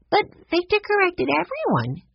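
put-on high, piping little girl voice; a young girl chiming in brightly; piped up. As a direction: pipe it up like a small girl. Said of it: "Sue Lee piped up".

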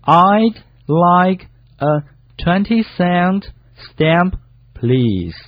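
high, very slow, separated words; slow practice reading. Shown as a demonstration. Co-host echoes the practice sentence for learners, very slowly. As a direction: read them one by one, stretched out. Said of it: "慢慢地一个一个读".

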